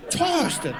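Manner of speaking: "Toasted" with the O stretched through all vowels; Australian accent. Shown as a demonstration. Practising Australian accent from ad, drawn-out. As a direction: drawl "Toasted" with a long gliding O. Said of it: "say the letter O".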